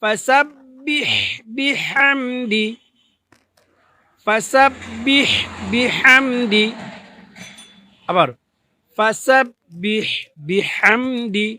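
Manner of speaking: ফাসাব্বিহ বিহামদি slow, each syllable drawn out, breathy হ; correct Arabic pronunciation. Shown as a demonstration. Teacher models the right way: ফাসাব্বিহ বিহামদি drawn out. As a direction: recite ফাসাব্বিহ বিহামদি slowly and carefully. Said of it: "দুইটা শব্দ একবার আমরা মিলাই".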